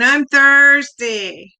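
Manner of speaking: drawn-out, high, loud whine; whining. A narrator's reading. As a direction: whine it like a toddler, stretching the word. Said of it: "she began to whine".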